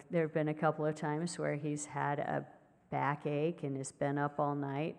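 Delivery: ordinary talk, nothing marked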